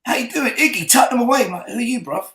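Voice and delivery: high, excited imitation of the visitors; Texan fans. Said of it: "these two guys from Houston, Texas".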